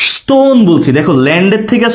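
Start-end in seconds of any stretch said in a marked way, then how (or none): 0.1-0.6 stone drawn out, pitched high; stress on the rhyme sound. Shown as a demonstration. Teacher shows students the word's sound, stone drawn out high. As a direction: stretch the vowel of stone to show its sound. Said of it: "sound".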